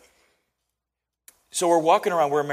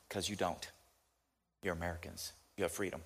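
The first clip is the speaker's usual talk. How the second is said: soft, low voice holding back emotion; choked up; 7 semitones lower, 14 dB quieter than usual. Retold or imitated. Interpreter tells a visitor he's American and free, choked up. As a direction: quote her quietly, moved by the words. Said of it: "Oh, man, choke me up".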